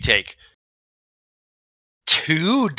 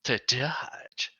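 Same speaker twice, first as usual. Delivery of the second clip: whisper; 3 semitones lower, 15 dB quieter than usual. Acted. GM announces the dodge penalty.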